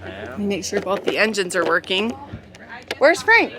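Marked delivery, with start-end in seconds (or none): 3.0-3.5 loud high call to someone far off; yelling across the room. Real, not acted. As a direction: shout it out over the wind. Calling out on a windy boat.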